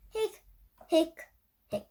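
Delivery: high little hiccups; hiccup sounds. A narrator's reading. Narrator voicing the skeleton's hiccups, high and light. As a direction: squeak out each hic like a hiccup.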